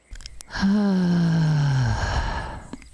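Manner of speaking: long, loud, open sigh falling in pitch; audible sigh. Shown as a demonstration. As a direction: let out a big releasing "hah". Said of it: "let out a nice audible sigh".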